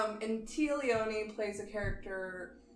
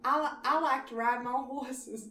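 put-on southern accent; 4 semitones higher than usual. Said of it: "in a southern accent".

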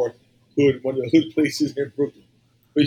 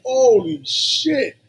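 drawn-out, much higher holy shit; shocked exclamation; 10 semitones higher, 4 dB louder, much slower than usual. Retold or imitated. Retelling seeing the rats, slow shocked cry. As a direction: stretch it out in disbelief. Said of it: "I was like".

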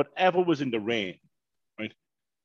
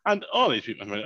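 ordinary talk, nothing marked

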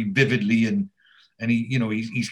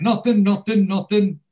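high, louder mock-innocent protest; guilty denial; 8 semitones higher, 5 dB louder than usual. Three lads caught out by their roommate, guilty mock denial. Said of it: "start looking a bit guilty".

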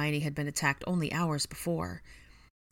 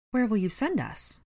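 mildly anxious, higher-pitched question; worried; 6 semitones higher than usual. A narrator's reading. Missus Creevy asks Harry where they will go, worried. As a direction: fret lightly, voice lifting. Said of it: "Missus Creevy worried".

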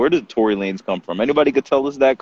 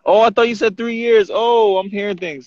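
surprised realization; 12 semitones higher, 5 dB louder than usual. Real, not acted. Realizing he misheard.

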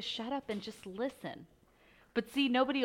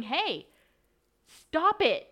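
blunt; 7 semitones higher, 8 dB louder, much slower than usual. Retold or imitated. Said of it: "blunt and honest and going".

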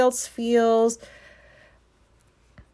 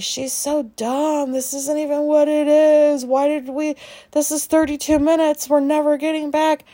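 higher, louder mocking voice of annoyed listeners; 6 semitones higher, 4 dB louder than usual. Imagined listeners mocking the speaker's episode, high and scornful. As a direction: sneer it out as a fed-up listener, pitched up. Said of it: "And everybody's like".

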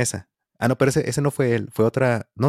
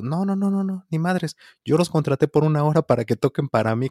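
high, emphatic no no no; bossy refusal; 6 semitones higher than usual. Retold or imitated. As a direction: imitate a pushy client laying claim. Drunk client refusing to share band.

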